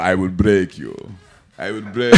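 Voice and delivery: low, menacing mock Russian threat; lightly voiced Drago from Rocky; Drago impression. Man quoting Drago to friends, low mock Russian menace. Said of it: "You gotta do that Drago joint".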